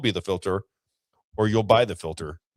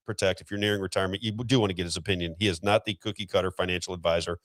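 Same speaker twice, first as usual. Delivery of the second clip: usual speaking voice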